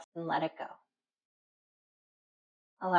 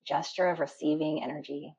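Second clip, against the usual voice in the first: ordinary talk, nothing marked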